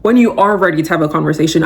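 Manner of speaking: normal talking voice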